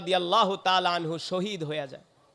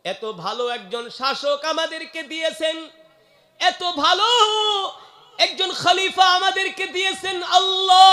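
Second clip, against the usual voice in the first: impassioned prayer cry; 11 semitones higher, 11 dB louder than usual. Acted. Omar praying to Allah for Abu Bakr, impassioned rising shout. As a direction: climb to a high, loud shout.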